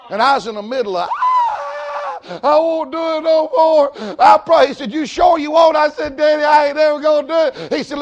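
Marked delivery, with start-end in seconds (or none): 1.1-4.7 high wailing plea, loud and crying; loud crying child pleading not to be whipped; crying child begging. Retold or imitated. 4.9-5.8 loud mock stern father; hammed-up loud stern daddy; father asking the crying boy, mock stern. Father asking during whipping. 6.2-7.7 put-on loud high pleading boy; boy promising his daddy, loud pleading; pleading child. Child promising during whipping.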